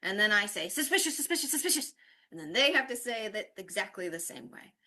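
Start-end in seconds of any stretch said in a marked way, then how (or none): 0.7-1.9 rapid-fire "suspicious" three times, rising high; playful repetition. Shown as a demonstration. Teacher surprising students in a drill, playfully rapid. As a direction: rattle off "suspicious" in a silly high run. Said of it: "it kind of makes them laugh".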